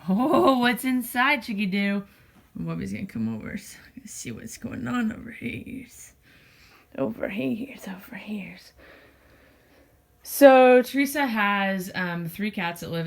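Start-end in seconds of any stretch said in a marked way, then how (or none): 0.1-2.0 pet voice. Real, not acted. Talking to the cat. 2.6-8.6 soft whisper to the cat; whisper, coaxing the cat closer. Real, not acted. Calling cat quietly.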